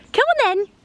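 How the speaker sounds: high, loud call; calling the dog. Real, not acted. Owner calling her dachshund out of the long grass, loud and high.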